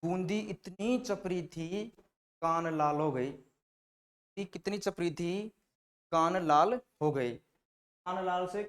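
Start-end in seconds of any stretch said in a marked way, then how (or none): 0.0-1.9 slow, stretching इतनी with a rise; drawn-out emphasis. Shown as a demonstration. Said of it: "अब याद कैसे करे". 3.3-5.5 very slow, lingering on Chapri with a rise; very slow emphasis. Shown as a demonstration. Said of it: "अब याद कैसे करे".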